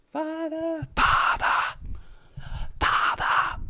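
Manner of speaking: high, soft, whispered call for Father; mock-tragic whisper to the heavens; hammed-up pleading to Father; dramatic whispered plea. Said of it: "I'm trying to whisper it".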